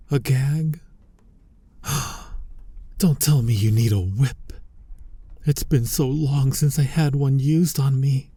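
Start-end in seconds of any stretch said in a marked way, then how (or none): 1.8-2.9 soft breathy gasp of feigned shock; mock gasp. Mock shock at whip idea. 3.0-8.3 lit up at the thought; excited. Acted. Excited about a whip.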